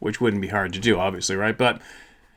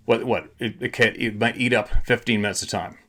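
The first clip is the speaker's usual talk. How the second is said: usual speaking voice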